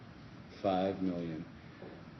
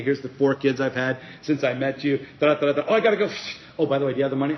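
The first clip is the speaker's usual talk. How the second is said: rushed chatty voice; 5 semitones higher, 9 dB louder, faster than usual. Retold or imitated. Retold chatty owner.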